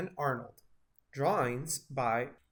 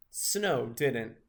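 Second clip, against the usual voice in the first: ordinary delivery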